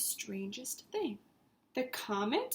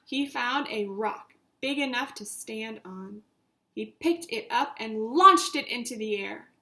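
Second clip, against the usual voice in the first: ordinary delivery, nothing marked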